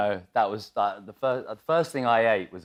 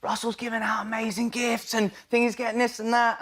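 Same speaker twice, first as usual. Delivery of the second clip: much higher, gushing mock excitement; excited gushing; 12 semitones higher than usual. Actor gushing over Russell's gifts, mock excited. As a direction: squeal about the gifts in mock awe.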